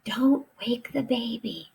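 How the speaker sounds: hushed mock whisper; soft voice. Shown as a demonstration. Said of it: "She whispered".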